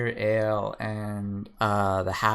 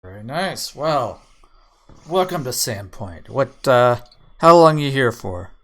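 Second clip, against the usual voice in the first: loud, eager half-orc welcoming the newcomer; half-orc talking up a stranger, loud and keen; friendly half-orc; 3 semitones higher, 11 dB louder than usual. Acted. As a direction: boom out a hearty barroom welcome. GM voices half-orc Gorvy.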